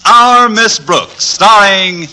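slow, grand announcer intro; stately slow show opener; radio announcer. Acted.

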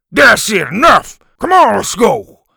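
loud, high shouted command; fed-up teacher's bellow; angry shout. A narrator's reading. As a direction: break up a fight by shouting. Teacher breaks up the fight.

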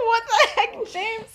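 high, laughing "what the heck"; flustered girl laughing at a compliment; laughing protest. Retold or imitated. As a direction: giggle through the embarrassed protest. Lily laughing, embarrassed by compliment.

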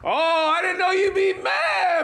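mock surprise. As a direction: stretch it high, feigning surprise at their anger. Heated mock surprise in argument.

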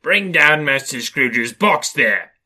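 loud, drawn-out booming call; terrible shout. A narrator's reading. Schoolmaster calls down the hall for Scrooge's box, terrible loud shout. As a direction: bellow it slowly down the hall. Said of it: "A terrible voice in the hall cried".

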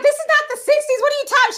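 high, loud incredulous squeal; mock protest. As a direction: protest it like she's being ridiculous. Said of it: "I'm like".

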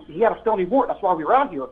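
ordinary delivery

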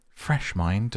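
ordinary delivery

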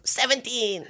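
put-on high, sly kid counting on; gleeful kid. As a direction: deliver the count with a high kid's glee.

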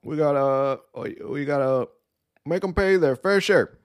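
mock Obama's stop-start cadence; Obama impression. Host mocking Obama's habit of saying uh, high halting impression. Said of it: "He always said".

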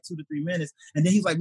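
ordinary talk

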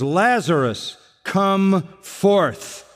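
drawn-out call, no real yell; mock commanding call. Preacher imitating Jesus calling the dead man, restrained call. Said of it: "he yells at the top of his voice".

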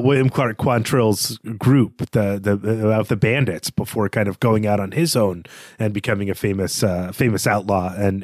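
usual speaking voice

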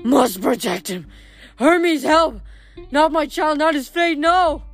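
strained, mostly high, desperate outcry; possessed rasp. A narrator's reading. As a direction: plead like a woman seized by a vision. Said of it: "she rasped in a much deeper voice".

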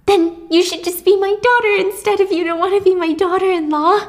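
high, eager acted mother's voice; insistent pleading. Mother pressing a stranger.

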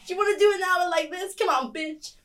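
hammed-up American bro goading; bombastic American. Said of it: "more bombastic when I'm American".

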